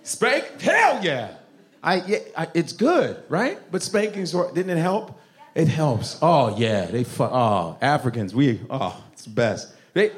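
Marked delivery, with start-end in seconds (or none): none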